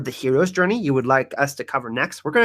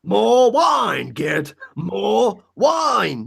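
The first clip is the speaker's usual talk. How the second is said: put-on drunken royal; 6 semitones higher, much slower than usual. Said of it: "make a remix out of that".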